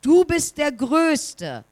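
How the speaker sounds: father calling out praise. A narrator's reading. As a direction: call it warmly across the mountains. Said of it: "Und nun ruft der Mann".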